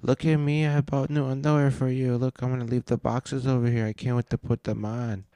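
high, hammed-up lovey-dovey voice; mocking lovestruck guest. Streamer mocks hotel guests for his viewers, in a high, silly, sugary voice. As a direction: mimic a giddy girlfriend showing off new underwear. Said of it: "She goes".

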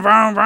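loud drawn-out vroom noise; imitated car engine. Friend rushing over to cuddle, loud mock engine revs. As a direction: rev it like an engine pulling up.

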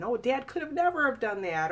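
disbelieving relative. Retold or imitated. Survivor mimicking a relative who refuses to believe, mock incredulous. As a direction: imitate a family member brushing it off. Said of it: "They don't wanna believe what happened".

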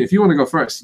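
ordinary talk, nothing marked

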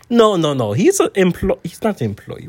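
German trainer correcting himself; German accent. Acted. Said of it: "is your accent German?".

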